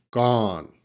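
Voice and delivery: "gone" with an open aw vowel, falling. Shown as a demonstration. Teacher modelling "gone" against "gun" for students, open and falling. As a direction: open the mouth wide for "gone", and let it fall. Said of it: "pronounce the word gun and gone".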